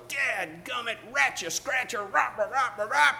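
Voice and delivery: high, strangled sputtering rant; mock cursing fit. Imagined passenger with coffee spilt on him, mock cursing fit. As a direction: sputter imitation curses into nonsense syllables. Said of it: "expected Ed to do like every other American would".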